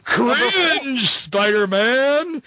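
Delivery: slow hammed-up villain threat; mock menacing supervillain. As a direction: drag out the cringe like a comic villain. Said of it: "he says".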